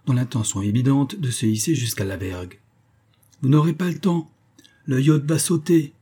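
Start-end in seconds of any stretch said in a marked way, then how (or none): 3.4-5.9 high-pitched urgent warning; pressing, high shout of alarm; urgent cry. A narrator's reading. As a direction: call it out urgently, voice raised in pitch. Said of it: "cria monsieur Jingle".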